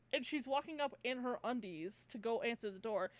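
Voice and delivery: normal talking voice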